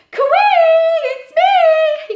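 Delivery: very high, loud call. Acted. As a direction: call out to a friend ahead. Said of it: "he called".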